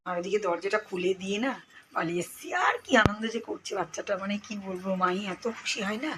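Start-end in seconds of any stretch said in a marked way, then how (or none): none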